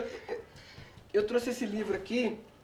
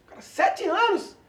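higher, drawn-out disbelief; incredulous protest; 10 semitones higher than usual. Acted. Disciple hearing he must meditate seven more years, incredulous. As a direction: stretch it out in rising disbelief. Reader voices disciple's shock at sentence.

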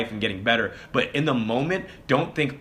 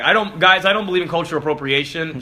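loud declaration; 7 semitones higher, 9 dB louder than usual. Real, not acted.

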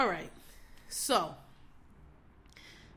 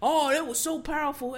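mock gushing fan; 3 semitones higher than usual. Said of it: "'cause he going".

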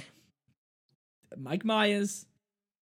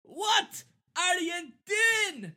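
high, slow, cracking shouted ogre line; hammed-up Shrek; 13 semitones higher, much slower than usual. Host reprising the ogre's famous line for his co-host, voice cracking. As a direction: bellow it so the voice breaks mid-line. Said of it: "I will reprise that performance".

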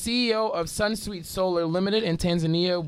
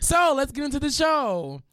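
much higher, energetic kickoff; show-opening announcement; 10 semitones higher than usual. Real, not acted. Host turning from silence to the show, upbeat announcement. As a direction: launch the show with a high lift.

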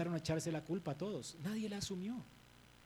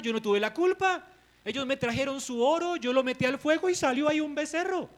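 loud, higher defensive excuse; voicing Aaron's whiny excuse; 6 semitones higher, 7 dB louder than usual. Aaron blaming Moses and the people, loud and defensive. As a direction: shift all blame with a wounded whine.

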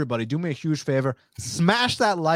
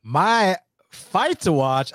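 slow, drawn-out announcement, a touch higher; dramatic announcer build-up; 4 semitones higher, much slower than usual. Real, not acted. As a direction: stretch out "My fight to watch" like a ring announcer.

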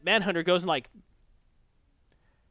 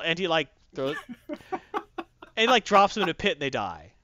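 normal talking voice

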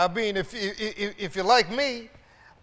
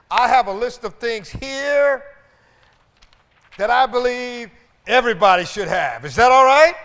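preaching; 5 semitones higher, 12 dB louder than usual. Acted. Preaching to the congregation.

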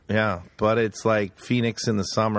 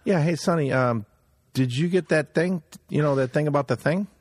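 lightly put-on Italian-American wiseguy, pitched up; Goodfellas Italian voice; 7 semitones higher than usual. Friend imitating an Italian pal's vague mob talk. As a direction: mimic a mob guy asking about the thing. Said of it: "he even talks like this".

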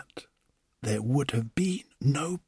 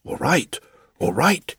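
flustered woodcarver; 5 semitones higher, 4 dB louder than usual. Acted. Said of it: "clapping his hands to his ears".

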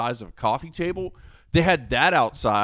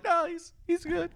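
much higher, mock casual wife voice; imitating the wife; 5 dB quieter than usual. Wife shrugging off her husband's behaviour, high mock voice. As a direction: brush it off in a lightly voiced wife voice. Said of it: "she's like".

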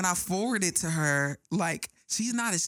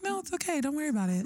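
sweet reassurance; 9 semitones higher, faster than usual. Retold or imitated. Host imitating Camille telling her not to worry, high and sweet. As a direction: soothe her like a kind friend, way up high. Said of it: "Camille said it so nice".

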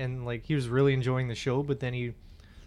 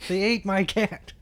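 higher, slow comic lament; mock protest; 6 semitones higher, 3 dB louder, slower than usual. Coyote shouter explains himself over a lost cat, slow mock protest. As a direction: draw it out like a put-on complaint.

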